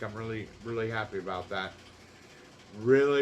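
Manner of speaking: ordinary delivery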